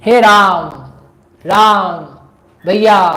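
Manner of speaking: loud, drawn-out call for a brother. Acted. Decoy voice luring Ram to battle, loud calling out. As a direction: call out for help in an imitated brother's voice. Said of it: "आवाज़ दी".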